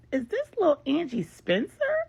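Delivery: high, delighted disbelief; realizing who's on the line; surprised. Acted. Lauren recognizing her old friend Angie, high and amazed.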